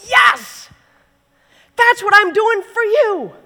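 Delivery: high, loud joyful shout; Jesus cheering over the homecoming, excited. Retold or imitated. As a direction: cheer like you've been waiting.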